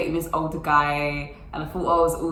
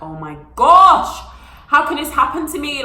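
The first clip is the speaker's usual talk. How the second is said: dismayed wail; 8 semitones higher, 9 dB louder than usual. Retold or imitated.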